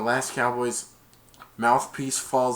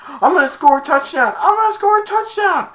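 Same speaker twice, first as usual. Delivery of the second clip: high, loud gleeful yell; excited yell; 9 dB louder than usual. Retold or imitated. Jake running for the touchdown, loud and high. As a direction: shout it while running. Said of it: "he's like".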